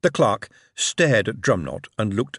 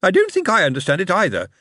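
mother's voice; 9 semitones higher, 5 dB louder than usual. A narrator's reading. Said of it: "said the mother".

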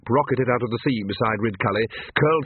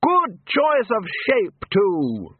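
high, slow hearty calling; drawn-out high call, dropping at the end; Ridcully, loud; 9 semitones higher, much slower than usual. A narrator's reading. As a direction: project praise to someone far off. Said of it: "Ridcully continued loudly".

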